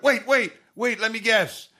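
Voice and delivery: high, eager guessing voice, very drawn out on the country; a wife sure she's got it. Retold or imitated. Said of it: "And my wife went".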